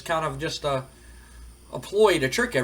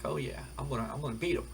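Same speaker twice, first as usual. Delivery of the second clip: softly, as if to himself; quiet self-assurance; 10 dB quieter, faster than usual. Retold or imitated. Left alone after rival walks off.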